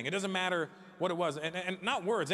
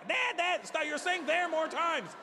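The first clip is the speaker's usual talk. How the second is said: exasperated shouting; 11 semitones higher than usual. Retold or imitated. Exasperated pointing argument.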